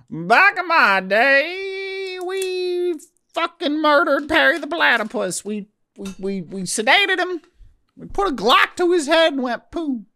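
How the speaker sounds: high, drawn-out cartoon villain accent; Doofenshmirtz voice. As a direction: ham up the mad scientist accent. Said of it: "sounds like Doctor Doofenshmirtz".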